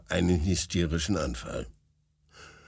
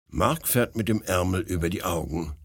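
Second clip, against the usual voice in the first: plain reading voice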